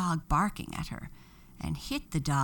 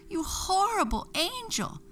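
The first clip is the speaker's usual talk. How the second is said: angry shout. A narrator's reading. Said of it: "Broccolina shouted".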